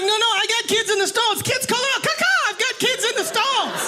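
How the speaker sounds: high-pitched frantic calling out; panicked protest. Acted. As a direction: blurt it out high, desperate to explain. Panicked explaining to strangers.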